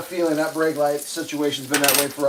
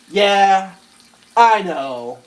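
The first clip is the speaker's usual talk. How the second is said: a hint of an unbothered driver; bored driver's reply; 4 semitones higher, 4 dB louder, much slower than usual. Driver brushing off the brake light warning, lightly unbothered. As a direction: shrug it off lightly. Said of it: "theatrical performance of exactly what happened".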